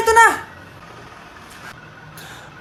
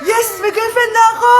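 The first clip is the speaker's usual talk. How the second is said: gleeful cheer; 5 semitones higher, 4 dB louder than usual. Acted. Robber celebrates new girlfriend.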